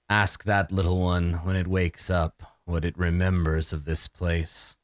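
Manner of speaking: low, grave vampire lord; brooding vampire. Acted. DM voices a weary vampire to the party, low and grave.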